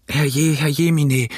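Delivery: slow, mildly wailed exclamation; wailing mother. Acted. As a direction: lament as a distressed mother. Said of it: "schrie meine Mutter". (klagender Ausruf der Mutter)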